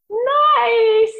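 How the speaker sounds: one long high, held note; high-pitched mock niceness. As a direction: draw it out high, mimicking enforced sweetness. Voicing what girls were taught.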